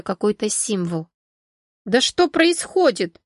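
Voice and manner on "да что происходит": high, slow, alarmed raised voice. Acted. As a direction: demand an answer, voice raised. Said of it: "повысила голос Валерия".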